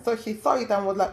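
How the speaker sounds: usual speaking voice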